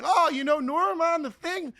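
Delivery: high-pitched excited squeal of recognition; suddenly thrilled, acting out instant rapport. Networker gushing over a mutual acquaintance, excited and high.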